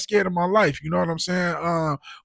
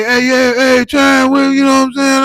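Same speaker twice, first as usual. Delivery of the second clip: loud, higher mock hype greeting; 7 semitones higher, 8 dB louder than usual. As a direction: hype him up like an old buddy. Retold greeting from a guy.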